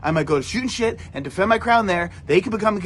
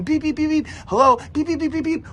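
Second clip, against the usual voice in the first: phone beeps; 6 semitones higher than usual. Retold or imitated. Imitating phone notification sounds.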